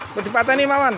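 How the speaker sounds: high, loud call over the engine; shouted request. Real, not acted. Mechanic asking the owner to switch off, shouted over engine noise.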